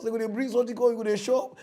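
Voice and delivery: usual speaking voice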